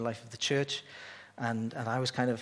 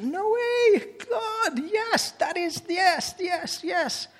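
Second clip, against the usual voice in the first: much higher, louder cry of amazed joy; high, excited yes-yes-yes of astonishment; amazed delight; 5 dB louder, much slower than usual. Retold or imitated. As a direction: burst out like someone stunned by good news. Acting out the people's delighted reaction.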